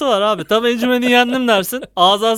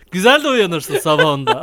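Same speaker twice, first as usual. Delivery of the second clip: ordinary talk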